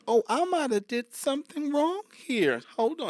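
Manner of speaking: high, slowed mock cashier voice; worried cashier. Cashier recounting change at Walmart, high mock worry.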